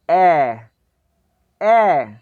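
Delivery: ए as an open a-umlaut, loud; ए umlaut called clearly; umlaut demo. Shown as a demonstration. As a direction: stretch ए like Urdu बड़ी ए. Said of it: "ए umlaut को pronounce करना".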